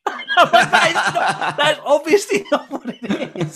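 loud, high protest through laughter; laughing protest. Retold or imitated. Child retorting to mum's non-translation, laughing protest. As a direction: crack up while protesting.

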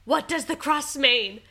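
confused call through the door; anxious question. Retold or imitated. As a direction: ask it urgently, confused. Asking after the pregnancy test.

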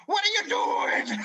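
very high, loud screech; yelling officer. Retold or imitated. Officer yells at the late recruit. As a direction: scream it at the recruit. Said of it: "he's like".

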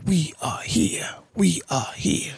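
breathy hushed whisper; whispered intro. Acted. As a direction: whisper it like a secret welcome. Podcast opening whisper, repeated.

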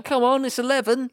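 high-pitched, hammed-up exasperated yell; mock impatience. Hungry customer tapping on Nando's door, mock impatient shouting. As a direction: demand it through the door, playing desperate.

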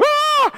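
short high-pitched mock scream. Unsure rider as the coaster starts moving, mock screaming. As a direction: yelp like a panicked coaster rider. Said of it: "they just scream".

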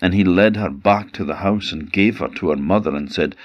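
ordinary delivery

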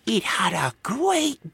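put-on slow, very high child voice; bobble hat. A narrator's reading. Child describing the pig's wee, high and drawn out. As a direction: relish it like a tiny kid. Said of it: "The bobble hat sniggered".

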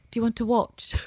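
a loose attempt at Villanelle's Russian accent, barely there; bad Villanelle accent. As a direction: toss off a half-hearted Russian-accented line as Villanelle. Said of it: "That's not Villanelle's accent".

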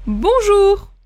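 bonjour poli, plus haut. Shown as a demonstration. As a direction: saluer poliment. (bonjour poli de démonstration, plus aigu)

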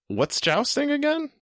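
very high, slow questioning voice; puzzled listener. Retold or imitated. Co-host voices confused listeners asking, high puzzled mimicry. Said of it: "all you people out there who are like".

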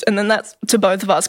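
ordinary talk

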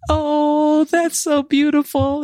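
very high, drawn-out adoring coo; imitated crowd cooing. Grandmother mimicking diners hearing of the baby, cooing. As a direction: coo sweetly like a touched crowd. Restaurant crowd coos at the news.

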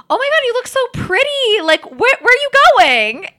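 very high, loud squealed gush; imitated gushing. Friend gushing over FaceTime, imitated high excitement.

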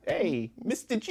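high, slow Brooklyn Harley voice; squeaky forties Brooklyn moll; Harley Quinn voice. Retold or imitated. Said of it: "that thick Brooklyn accent".

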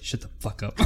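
whispered mock telling-off; hushed retort. Drunk actor to someone in rehearsal, whispered retort. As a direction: whisper it as a retold curt comeback.

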